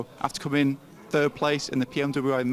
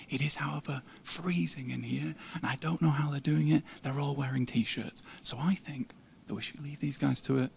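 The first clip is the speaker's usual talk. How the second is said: soft, half-whispered; hushed voice; 8 dB quieter than usual. Real, not acted. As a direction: keep it down near the editors. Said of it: "We better be quiet here".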